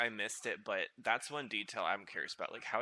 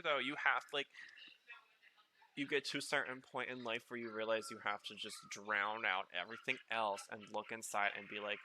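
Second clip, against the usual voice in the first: usual speaking voice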